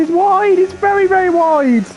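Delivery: calling it out on the edge of the seat; tense excitement. Real, not acted. Tense moment watching a rival's lap.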